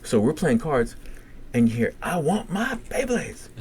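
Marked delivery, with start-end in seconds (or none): 2.0-3.5 high, slow imitated demand; tantrum yell. Patient demanding his toys from the nurses, tantrum. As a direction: draw each word out in a high mock tantrum. Retold outburst heard from down hall.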